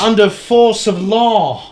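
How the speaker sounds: put-on slow, high-pitched British accent; pompous, drawn-out caricature of a Brit; mock British accent. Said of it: "Did we do accents".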